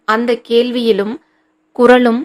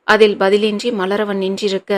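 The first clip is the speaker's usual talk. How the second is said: ordinary delivery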